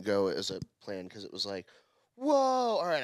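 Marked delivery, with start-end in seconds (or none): 2.2-3.0 high-pitched, stunned whoa; overwhelmed reaction. Retold or imitated. Stoned comic suddenly having to perform, overwhelmed. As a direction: act thrown, voice jumping up high. Dazed comic sent onstage after surreal talk.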